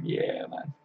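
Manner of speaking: voiceless whispered agreement; just breathe the words. Real, not acted. Streamer agreeing with chat under his breath, whispered.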